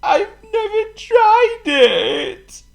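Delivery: very high, drawn-out tearful wail; hammed-up crying; sniffling king. A narrator's reading. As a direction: wail it slowly through sniffles. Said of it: "sniffed the king".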